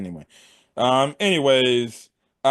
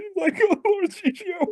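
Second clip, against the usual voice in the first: hammed-up high sobbing plea, far higher; mock crying. Follower crying to his lord, high mock sob. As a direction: whimper it like a blubbering follower. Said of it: "this man's over here crying".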